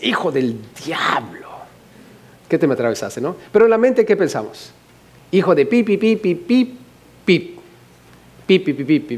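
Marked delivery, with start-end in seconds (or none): 0.0-3.2 hushed, breathy-free whisper of an insult; whispered curse. Retold or imitated. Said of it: "Y con la boca dices". 5.3-9.2 bleeped curse. Retold or imitated. Censored swearing in the mind.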